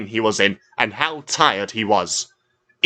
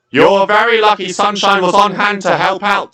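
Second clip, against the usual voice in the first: higher, louder stern scolding; 10 semitones higher, 6 dB louder than usual. A narrator's reading. The dockmaster scolds Top Hat, louder and higher. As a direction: tell off the tug firmly. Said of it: "I scolded".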